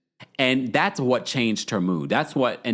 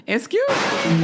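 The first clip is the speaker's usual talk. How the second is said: indignant; 10 semitones higher, 3 dB louder, slower than usual. Real, not acted. Indignant reaction to cast member.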